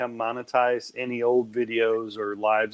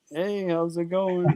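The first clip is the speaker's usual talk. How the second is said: slow, drawn-out neighborly greeting; higher-pitched, unhurried neighbor impression; Brother Brett; 5 semitones higher, 4 dB quieter, slower than usual. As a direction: stretch it out like a friendly over-the-fence hello. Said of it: "That's Brother Brett".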